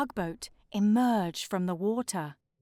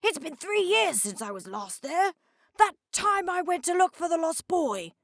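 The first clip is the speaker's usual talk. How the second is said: higher, friendly little hydrocopter voice; Harry the hydrocopter; 8 semitones higher than usual. Acted. Harry recalling an old trip to the lighthouse, in a higher voice. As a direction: play the cheerful small hydrocopter, a bit drowsy.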